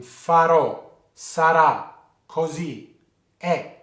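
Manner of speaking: farò, sarà, così, è with a strong stressed last vowel; final-syllable accent. Shown as a demonstration. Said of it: "it's quite strong sounding".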